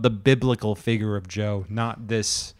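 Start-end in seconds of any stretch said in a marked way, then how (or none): none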